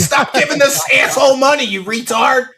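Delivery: loud, high-pitched yell imitating irate chatters; mock angry yell. Chat members yelling at a donor, mock outraged shout. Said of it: "yell at them".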